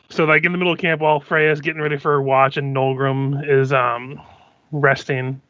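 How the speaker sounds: ordinary talk, nothing marked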